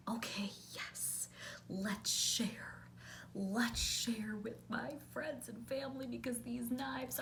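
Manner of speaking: breathy eager whisper, picking up; hushed giddy agreement; excited whisper. Retold or imitated. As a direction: whisper it like a thrilled secret. Eager naive self in story.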